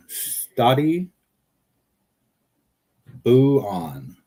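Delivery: Stati rising high then buon falling; playful Italian sing-song. Shown as a demonstration. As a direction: lift Stati up, drop buon. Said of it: "Stati boon".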